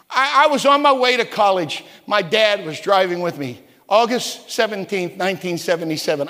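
normal talking voice

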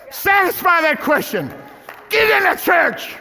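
building from firm to full shout; rising preaching shout. Real, not acted. Preacher shouting at the congregation to get saved.

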